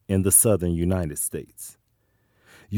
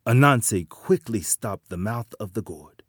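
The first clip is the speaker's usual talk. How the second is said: usual speaking voice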